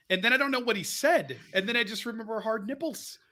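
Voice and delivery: ordinary talk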